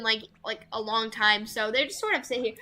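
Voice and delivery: usual speaking voice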